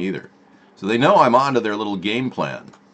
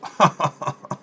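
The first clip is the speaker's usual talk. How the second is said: villain laugh; 6 semitones higher than usual. Pretend. Laugh after the mock Russian line.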